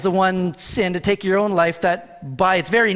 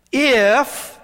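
if lifted high and louder, held alone; stress on IF; 5 semitones higher, 4 dB louder than usual. Shown as a demonstration. Pastor reading scripture to the church, stressing if. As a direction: lean hard on the word if, then pause. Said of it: "I'm gonna stress a word".

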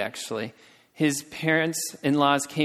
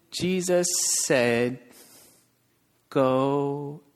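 very slow, drawn-out words with gaps; halting beginner reading; much slower than usual. Retold or imitated. First grade reader reading scripture to brothers, slow and halting. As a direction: imitate a new reader sounding out each word. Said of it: "just reading like that".

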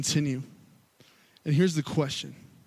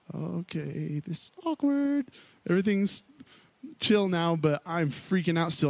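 awkward disciples; 2 semitones higher than usual. Retold or imitated. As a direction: play the embarrassed silence. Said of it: "these guys just standing there like".

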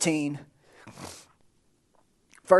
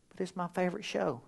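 much softer, quick little whine of an excuse; soft protest; 13 dB quieter, faster than usual. Acted. Churchgoer answering the inner voice, soft quick excuse. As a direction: sneak the excuse out under your breath.

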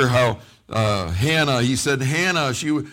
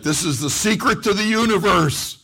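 high, loud retold revelation; hammed-up excited proclamation; awestruck enthusiasm; 6 semitones higher, 4 dB louder than usual. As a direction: proclaim it like a huge discovery. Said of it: "to me it was like".

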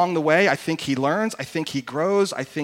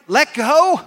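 high, loud "Let go?" lifting at the end; "Let go?" as a doubting, raised question; incredulous question; 9 semitones higher, 6 dB louder than usual. Acted. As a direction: query "Let go?" in disbelief. Man doubts God again.

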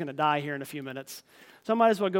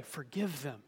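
soft plea; 5 semitones lower, 10 dB quieter, slower than usual. Acted. Jesus's words repeated softly.

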